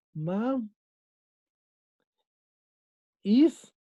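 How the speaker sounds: "ma-, is" en dos golpes con pausa larga, el segundo más agudo; separado en sílabas. Shown as a demonstration. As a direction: separa "ma-" de "is", subiendo en "is". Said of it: "es una palabra aguda". ("ma-, is" silabeado, con acento en "is")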